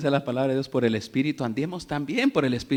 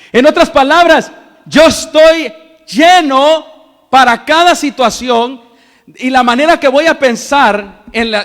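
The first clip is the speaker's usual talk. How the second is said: loud, driving pulpit delivery, building to the congregation; fiery preaching; 8 semitones higher, 15 dB louder than usual. Acted. Pastor to the congregation, a loud fiery sermon. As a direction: preach it out loud and high to the church.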